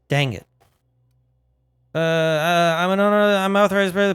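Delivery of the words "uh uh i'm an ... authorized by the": louder, higher panicked pleading. Real, not acted. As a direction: blurt it out in a panic. Panicking as weapons prime.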